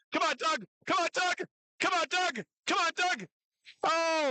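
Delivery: high-pitched, repeated urging; excited rooting, pitch way up; urgent cheering. Real, not acted. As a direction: push him to finish the lift. Announcer urging lifter through attempt.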